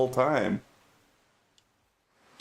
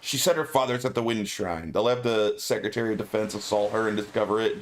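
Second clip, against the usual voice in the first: normal talking voice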